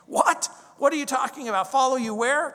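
a high, loud, disbelieving "What?"; incredulous disciples. Retold or imitated. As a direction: play the stunned disciples, pitch jumping up. Disciples react to death prediction.

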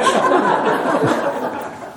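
quick high breathy laugh; a brief laugh on the breath; short airy laugh. Real, not acted. Bald monk laughing about hair gel.

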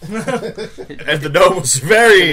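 slow high laughing. Real, not acted. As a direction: laugh through it. Laughing while telling.

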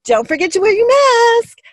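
high, loud imitation of a passing cyclist; mock calling out. Girl on her bike reminding a walker about masks, high mock call. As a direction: call it out high, stretching the last word.

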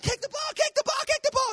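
very high, frantic cheering; retold sideline yell. Dad retelling cheering his son toward the goal, frantic high yell. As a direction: yell it like a sports parent losing it. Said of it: "You know, I'm freaking out".

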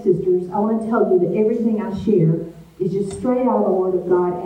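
usual speaking voice

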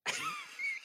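bursts into high laughter. Real, not acted. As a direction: cracks up at his own joke. Punchline lands, he laughs.

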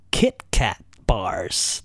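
slow, low countdown reveal; dramatic reveal. Real, not acted. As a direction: draw out the number one announcement. Number one reveal after drum roll.

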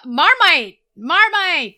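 loud, high mock-Aussie shout; attempted Australian accent. Host guesses what Australians say, loud attempted Australian accent. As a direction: call it out in a put-on Aussie accent. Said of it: "is that Australian?".

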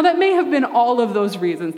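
usual speaking voice

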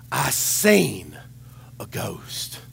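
a breathy, hushed I seen a ghost; hushed scared report. Retold or imitated. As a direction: say it low like it might hear you.

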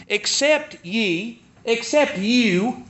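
"Except" drawn out slowly with a rise each time; slow weighted reading. Shown as a demonstration. Preacher reading scripture to the congregation, slow and deliberate. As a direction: read the scripture slowly, weighing every word. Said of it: "Listen to how it reads".

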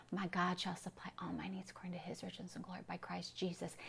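hushed, whispered scripture recital; very soft bedtime whisper of a memorized verse; whispered to oneself. Shown as a demonstration. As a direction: whisper it so nobody wakes. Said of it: "You don't wanna wake up your spouse".

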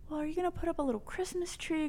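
high, fast sing-song coaxing; high fast coaxing social worker. Retold or imitated. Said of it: "social workers at school was like".

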